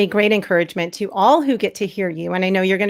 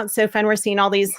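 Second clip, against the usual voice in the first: ordinary talk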